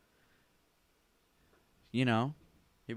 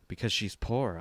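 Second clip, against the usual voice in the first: ordinary talk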